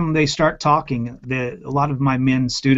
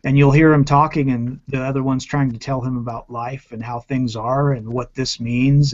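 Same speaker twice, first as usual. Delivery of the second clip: ordinary talk, nothing marked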